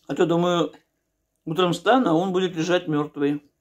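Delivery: talking while chewing; words muffled by food; mouth full. Real, not acted. Seen 1: Takes forkful at 1.5, then chews and speaks with full mouth.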